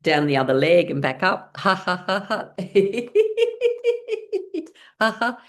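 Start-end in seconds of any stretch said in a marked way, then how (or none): 1.6-5.4 high, rapid staccato laugh; pitched-up exercise laugh; fake laughter. Shown as a demonstration. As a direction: force out rising ha-has while tapping. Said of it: "Making your laughing sounds".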